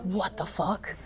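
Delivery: very soft stunned aside; hushed disbelief. Retold or imitated. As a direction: drop right down, stunned under your breath. Stunned aside at doors opening.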